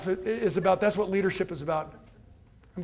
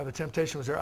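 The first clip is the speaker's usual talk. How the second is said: ordinary delivery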